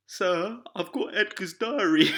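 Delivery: high, slow pleading schoolboy voice; sing-song teacher's-pet whine; mock innocent excuse. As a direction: play the cheeky kid with a fake excuse. Mimicking a classmate's excuse.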